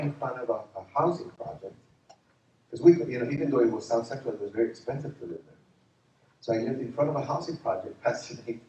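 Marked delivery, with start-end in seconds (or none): none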